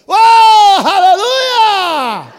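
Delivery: long, drawn-out, very high cry of praise; ecstatic hallelujah shout. Retold or imitated. Preacher shouting hallelujah over a plate of meat. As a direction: wail it out like a miracle. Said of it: "fiz assim".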